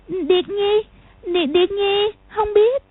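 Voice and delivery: put-on high, frightened child stammering; a scared girl stuttering out an answer. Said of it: "ấp úng nói". (giọng bé gái ấp úng sợ hãi)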